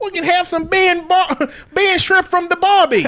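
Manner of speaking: put-on Australian accent breaking into laughter; a hint of Australian accent through laughs; laughing Australian accent. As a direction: play up the Aussie cliché, laughing. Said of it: "Australian would be like".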